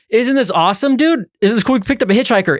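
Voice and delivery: lightly acted enthusiasm; mock excitement. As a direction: show off your find to a friend. Acted driver thrilled about the hitchhiker.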